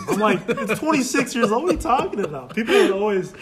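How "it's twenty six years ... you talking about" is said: high, incredulous comeback; retold protest. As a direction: argue back in mock disbelief. Retold protest to those freaking out.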